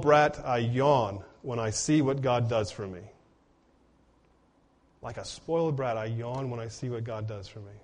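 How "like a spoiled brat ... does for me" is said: softer, lower, letting it sink in; soft repeat. Shown as a demonstration. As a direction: say it again quietly so it lands. Quote repeated softer for emphasis.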